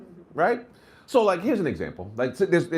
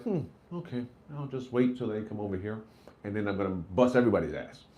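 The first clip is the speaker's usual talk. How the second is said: quiet scheming; 5 semitones lower, 6 dB quieter than usual. Retold or imitated. Coach plotting quietly.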